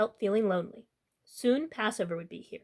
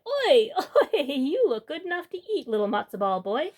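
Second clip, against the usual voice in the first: greedy gossip; 4 semitones higher, 4 dB louder than usual. Acted. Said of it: "dropped her basket and cried".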